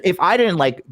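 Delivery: ordinary talk, nothing marked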